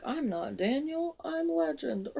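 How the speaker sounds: high, hammed-up villain with a laugh; gloating villain reveal. Villain unmasks himself to Scarlett, gloating in a high mocking voice.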